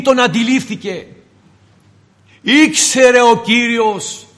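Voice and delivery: preaching. Acted. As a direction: proclaim to the congregation, stressing each phrase. Preacher addresses congregation forcefully.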